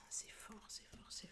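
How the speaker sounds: excited breathy whisper. Real, not acted. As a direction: whisper in growing awe. Awed reaction to card.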